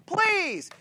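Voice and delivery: high, drawn-out plea; pleading. Shown as a demonstration. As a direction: beg with one stretched word. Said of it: "not like pleading, like".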